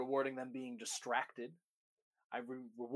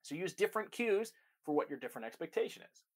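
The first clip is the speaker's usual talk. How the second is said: ordinary delivery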